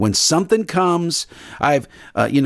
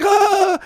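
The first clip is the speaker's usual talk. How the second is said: put-on high, short childish cry; mock kid's yelp. Preacher imitating a child's yelp at the needle. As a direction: yelp like a kid getting a shot. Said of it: "he's like".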